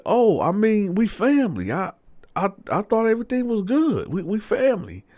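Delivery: high-pitched, stammering excuse; defensive innocence. Retold or imitated. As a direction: act out a man backpedalling, voice up high. Man acted, excusing himself.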